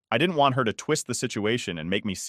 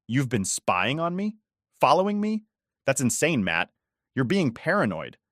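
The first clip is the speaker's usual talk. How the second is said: defensive; 5 semitones higher than usual. Acted. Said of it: "started getting defensive".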